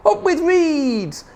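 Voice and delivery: slow, high drawn-out hoot. A narrator's reading. Duckbills cheering the reeds, high slow hoot. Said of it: "And they hooted".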